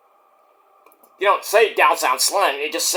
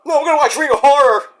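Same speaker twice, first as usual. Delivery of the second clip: excited fan voice; 7 semitones higher, 4 dB louder, faster than usual. Retold or imitated. Voicing a fan.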